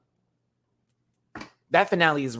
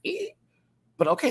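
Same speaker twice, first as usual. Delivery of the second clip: ordinary talk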